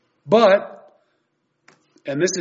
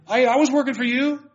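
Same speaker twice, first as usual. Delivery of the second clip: pleading claim; 8 semitones higher than usual. Pretend. False believers pleading on Judgment Day.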